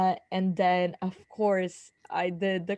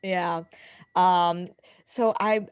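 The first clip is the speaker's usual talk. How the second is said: ordinary talk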